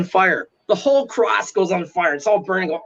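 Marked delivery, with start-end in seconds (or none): none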